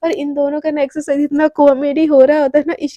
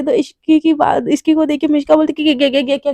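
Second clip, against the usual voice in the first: usual speaking voice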